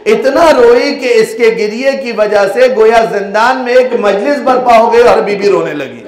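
wailing lament preaching. Acted. Preacher lamenting the weeping child to the gathering, keening. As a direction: cry the story out to the mourners.

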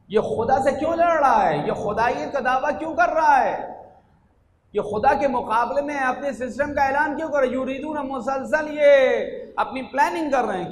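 impassioned preacher challenging his audience; fervent preaching. Real, not acted. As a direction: demand an answer from the crowd. Preacher at full pitch.

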